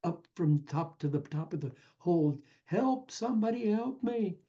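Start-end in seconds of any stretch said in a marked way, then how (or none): 2.7-4.4 mock yell for help. A narrator's reading. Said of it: "he starts yelling".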